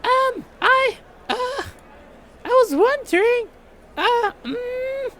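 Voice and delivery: put-on very high, hesitant girly voice; shy Mia voice. Mia working up courage to ask him, very high shy voice. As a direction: stammer it out like a bashful schoolgirl.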